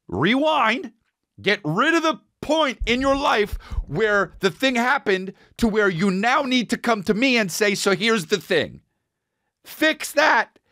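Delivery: high, slow and scolding; high mock lecture. Comic scolding his tour manager in a high voice.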